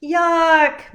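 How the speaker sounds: loud high yuck; child's loud yuck; disgusted. Acted. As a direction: blurt out disgust. Girl finds eggs in her backpack.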